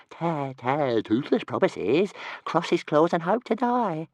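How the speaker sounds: a small excited dragon tripping over his words; little dragon voice. Acted. Toothless swearing a promise.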